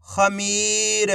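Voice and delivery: whole word in one go, vowels held; syllables joined. Shown as a demonstration. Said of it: "तो मिलके बन जाएगा".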